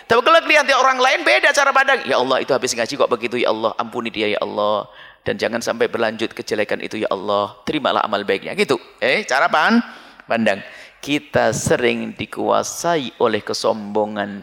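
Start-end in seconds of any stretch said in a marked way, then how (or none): 2.0-8.5 softer prayer. Acted. Voices a prayer for another.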